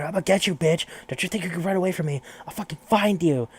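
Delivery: hammed-up angry shout after her; rushed high threat, played for the retelling; retold threat yelled. Killer shouting after fleeing woman.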